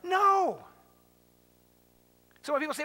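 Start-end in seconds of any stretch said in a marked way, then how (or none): 0.0-0.7 a sharp, high, loud no; emphatic no. Acted. Preacher answers his own question with an emphatic no. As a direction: slam the answer down hard.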